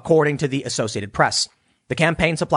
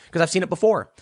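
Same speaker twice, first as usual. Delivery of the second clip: ordinary talk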